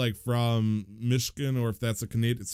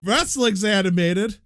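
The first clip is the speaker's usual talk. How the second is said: loud, slow, higher and incredulous; mock outrage; 13 semitones higher, 7 dB louder, slower than usual. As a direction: act scandalized at the very idea. Mock outrage at wrestling claim.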